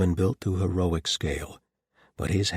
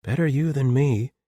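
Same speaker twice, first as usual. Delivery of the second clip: lightly higher female character voice; Clara's voice; 8 semitones higher, 6 dB louder than usual. A narrator's reading. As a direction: voice Clara, brisk and dry. Clara quips.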